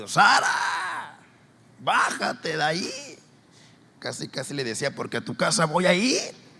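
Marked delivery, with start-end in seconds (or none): 0.1-3.2 high, loud shout of a name, then slow; yelling at a child. Retold or imitated. Neighbor yelling at a girl. 4.9-6.5 threat. Retold or imitated. Retold threat to a child.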